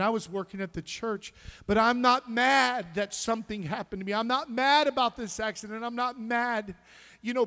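high and loud, building to a shout; impassioned preached prayer. Acted. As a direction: cry out thanks to God with full force. Preached prayer, rising intensity.

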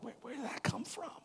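high, hushed, startled; startled inner voice. Pretend. Preacher voicing a listener's startled inner thought, high and hushed. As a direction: ask it like the thought caught you off guard.

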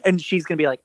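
usual speaking voice

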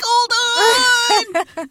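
comic strained high yell; mock panicked shout. Voicing her straining pants seams, mock panicked shout. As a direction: yell as overstretched seams hanging on.

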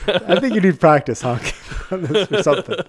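laughing while talking. Real, not acted. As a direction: talk through a laugh. Laughing at the honking.

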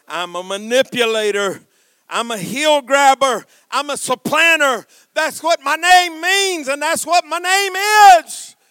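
rising from firm voice to high, loud cry; confession building. Acted. Jacob confessing his name to God, building outcry.

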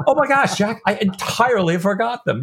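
high-pitched mock exclamation; hammed-up, high exasperation; exasperated Stephen. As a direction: imitate a flustered friend crying out. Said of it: "I wanna say, Stephen going".

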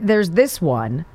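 normal talking voice

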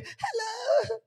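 hammed-up girlish phone hello; sweet girlish hello. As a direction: coo the hello. Unmarried girl answers phone.

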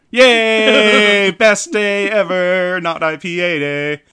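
loud, high, slow cheering; ecstatic cheer. Real, not acted. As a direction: celebrate wildly. Celebrating the beer.

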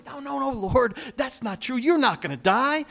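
high, loud protest from Peter; Peter's loud, high-pitched objection; pleading Peter. Retold or imitated. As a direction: cry out in alarm as Peter. Peter rebuking Jesus, voiced.

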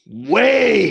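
"way" loud and drawn out; exaggerated stress. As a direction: stretch "way" for the laugh. Comic repeat of how wrong.

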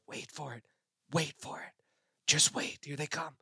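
hushed, urgent hold-back; whispering. Retold or imitated. Father whispering to his son in the duck blind, hushed. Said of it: "I'm whispering as I see this big flock".

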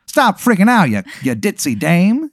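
put-on old-timey gangster introducing himself; 1920s gangster. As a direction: cactus gangster saying his name. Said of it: "He goes".

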